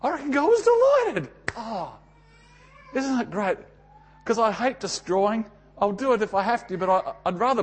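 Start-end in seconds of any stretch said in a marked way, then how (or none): 0.0-3.6 delighted. Real, not acted. Preacher delighted about God.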